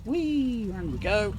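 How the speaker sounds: high delighted squeal; gleeful whee. Real, not acted. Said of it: "Don't know why I just went".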